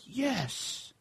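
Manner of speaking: put-on quiet high kid's yes; hushed little-boy yes; childlike yes. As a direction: answer like a proud seven-year-old. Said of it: "I'm like".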